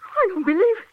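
high, stunned gasp of disbelief; the news hits her like a blow; shocked disbelief. Acted. Nurse hearing her doctor was murdered, in shocked disbelief.

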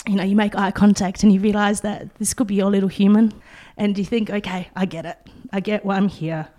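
holding back tears while speaking; steadying shaky voice. Real, not acted. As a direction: voice wavering with emotion. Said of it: "sorry, I'm getting a bit emotional now".

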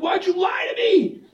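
high, furious mock shout; angry king. King shouting at Abraham over the lie, furious. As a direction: demand it like an enraged king. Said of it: "the king was so mad".

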